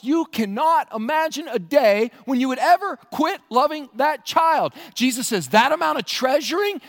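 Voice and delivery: rising fiery sermon voice; preaching at full force. Acted. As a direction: preach it hard, pleading with the room. Preaching passionately on Mother's Day.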